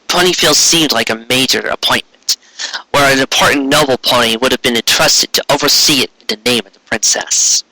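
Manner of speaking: ordinary delivery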